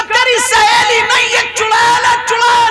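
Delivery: high shouted warning; alarmed warning. Acted. Mother shouting to her daughter that it's a witch, high alarm. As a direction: cry out that the friend is a witch.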